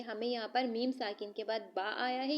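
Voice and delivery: normal talking voice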